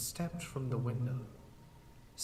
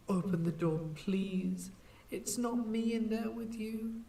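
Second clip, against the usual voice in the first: desperate plea; 10 semitones higher than usual. Acted. Pleading through the door.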